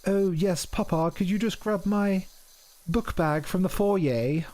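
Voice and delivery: put-on high-pitched posh English child to Papa; mock upper-class English, prim request to Papa; posh English accent. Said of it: "God, it sounds posh".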